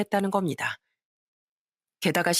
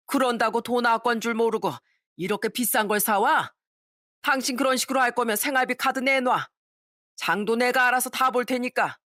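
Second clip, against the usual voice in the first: angry father-in-law; 5 semitones higher than usual. A narrator's reading. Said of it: "또 버럭 화를 내시는 거예요".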